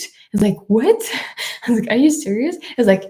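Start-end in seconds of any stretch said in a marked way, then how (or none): 0.7-1.0 higher, louder retold "what?!"; surprised disbelief. Retells disbelief at officer's advice. 1.9-2.6 higher-pitched retold disbelief; incredulous question. Retells disbelief at officer's advice.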